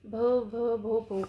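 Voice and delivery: imitating a girl's stammer on her name; mock stammer, catching on the first sound. Shy girl tries to say her name, stammering. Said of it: "अब उसने फिर से हकलाते हुए कहा".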